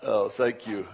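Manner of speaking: ordinary talk, nothing marked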